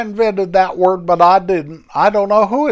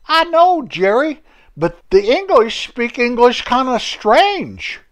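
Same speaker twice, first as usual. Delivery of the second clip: put-on lightly raised folksy old fellow, cheerful; chatty old Homer greeting his friend; 8 semitones higher than usual. Homer greets his old friend Jerry, lightly raised folksy voice.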